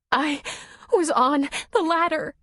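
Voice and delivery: high, halting, tremulous speech; shaking voice. A narrator's reading. Nora tells Tom what happened, voice shaking. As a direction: force it out in a shaken high voice. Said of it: "her voice shaking".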